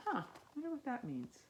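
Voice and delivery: plain reading voice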